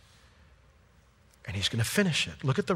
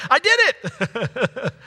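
mock boast; 10 semitones higher, 6 dB louder, much slower than usual. Boasting believer mocked.

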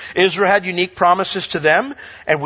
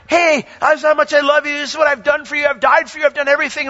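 God speaking; 9 semitones higher, faster than usual. Retold or imitated. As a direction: play the Lord gushing with love, pitched way up. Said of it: "the Lord's like".

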